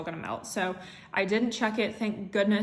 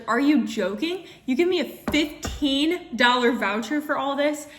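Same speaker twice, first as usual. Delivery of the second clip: indignant disbelief; 5 semitones higher, 5 dB louder than usual. Retold or imitated. Outraged at the denial email.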